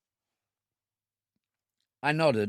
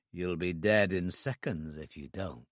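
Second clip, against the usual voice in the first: lower, softer icy menace; cold threat; 7 semitones lower, 6 dB quieter than usual. A narrator's reading. Gunman answers the pleading, low cold threat. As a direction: say it quiet and deadly.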